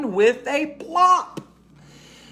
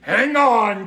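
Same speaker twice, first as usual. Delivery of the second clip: mild shout; 4 semitones higher, 5 dB louder than usual. A narrator's reading. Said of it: "Tiny shouts".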